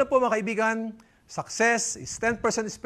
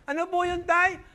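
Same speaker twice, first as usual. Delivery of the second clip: son answering; 9 semitones higher than usual. Acted.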